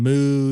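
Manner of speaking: playful cow moo to a waiter; mock cow moo. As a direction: moo like a cow to mime beef. Mooing to ask if beef.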